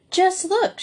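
bright proud exclaim, pitched high; showing off her baking, proud and pleased. A narrator's reading. Story Girl shows off her bread to the boys, proudly. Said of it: "she said proudly".

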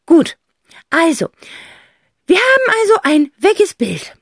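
high, loud aunt voice; aunt summing up. A narrator's reading. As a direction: sum it up with mock gravity. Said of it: "fasst Tante Rotz zusammen".

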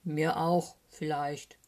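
hesitant bear grumble; lightly voiced gruff bear; grumbling bear. A narrator's reading. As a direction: grumble it, reluctantly admitting. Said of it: "brummt Eddie Bär".

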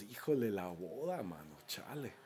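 low, soft retold groan of dismay; sinking, dropping away softly on the last words. Guests dismayed on arriving at a stormy wedding, dismayed.

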